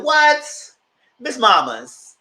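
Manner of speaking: loud, high "What?" in disbelief; shocked outburst. Real, not acted. Speaker reacting to the pregnancy news, shocked outburst. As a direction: gasp it out, stunned by the news.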